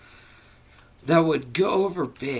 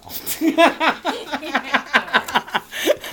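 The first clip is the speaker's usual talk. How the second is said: loud high laugh; 13 semitones higher, 6 dB louder than usual. Real, not acted. Reader laughs at own joke.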